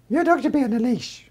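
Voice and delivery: high, prim stranger's rebuke; passerby telling off the dog walker; mock scolding passerby. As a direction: look down your nose at the dog owner. Passerby scolding about leash.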